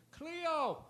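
high shouted call from far back; yelled name. Retold or imitated. Fan in the back shouting the dog's name at the comic, high yell. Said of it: "yelled out the name".

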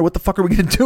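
Tasmanian Devil frenzy. Retold or imitated. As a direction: whip the repeat up into a frantic climax. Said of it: "I spin up Tasmanian Devil style".

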